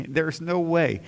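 ordinary talk, nothing marked

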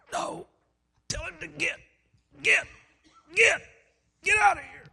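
high, strangled outburst, building to a shout; agitated. Retold or imitated. Dying husband ordering preacher out, agitated. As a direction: imitate choking out words in bursts. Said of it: "began to get a little bit agitated".

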